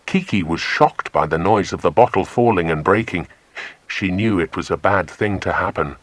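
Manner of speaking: usual speaking voice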